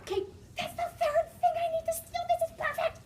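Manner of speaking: put-on high thrilled girl voice; delighted high voice; excited whisper-squeal. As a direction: squeal at the prize. Kate thrilled in the closet.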